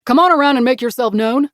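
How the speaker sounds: loud call into the dark. A narrator's reading. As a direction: raise your voice to a stranger. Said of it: "Wade said, raising his voice".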